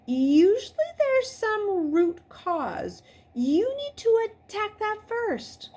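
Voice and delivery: calmly dispense wisdom, unhurried; untroubled. A narrator's reading. Mister Talbot calmly advises Luke, sounding untroubled. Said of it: "still sounding untroubled".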